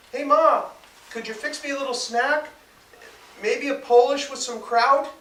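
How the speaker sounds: high, loud yell from the bathroom. Acted. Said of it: "he yelled".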